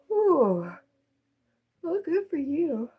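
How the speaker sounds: sky-high, teasing ooh then mock praise; mock impressed. Teasing a viewer who slept all day, mock impressed. Teasing Daniel about sleeping late.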